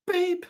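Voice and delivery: squeaky high beep; imitated beep. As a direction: mimic the tiny beep. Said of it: "it's just going beep".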